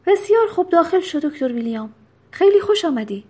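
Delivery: loud, high-pitched burst of welcome, easing then lifting; hearty welcome. A narrator's reading. Grinning jailer welcoming the doctor inside, hearty and loud. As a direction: usher the guest in heartily with a grin.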